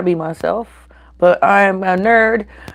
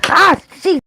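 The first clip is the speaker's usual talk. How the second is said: pained yelp; 13 semitones higher, 4 dB louder than usual. Real, not acted. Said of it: "I just burnt myself".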